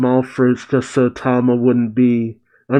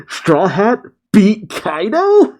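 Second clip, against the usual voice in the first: higher, slow, stunned question; shocked disbelief; 8 semitones higher, slower than usual. Retold or imitated. Apu hearing the news of Kaido, stunned and slow. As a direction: let the disbelief stretch each word. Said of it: "who's just shocked".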